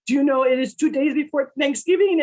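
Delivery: indignant stern Frenchman; strict Frenchman. Retold or imitated. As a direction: boss scolding her, two days before Thanksgiving. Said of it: "very strict Frenchman".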